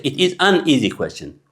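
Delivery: very slow, "is" and "an" full and separate; wrong full form. Shown as a demonstration. Teacher showing the wrong way again. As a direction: say every word apart. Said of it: "We don't say".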